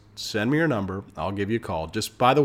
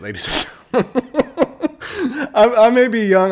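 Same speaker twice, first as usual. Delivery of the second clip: real laughter breaking through, much higher and louder; laughing out loud at his own line; 12 dB louder, slower than usual. Real, not acted. Cracks up at own brag.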